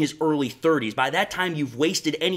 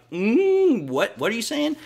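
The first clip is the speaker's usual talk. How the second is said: incredulous; 8 semitones higher than usual. Retold or imitated. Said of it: "I think was like".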